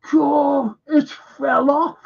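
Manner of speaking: high-pitched mock horror; retold horrified gasp. Onlooker sees the arm come off, mock horrified cry.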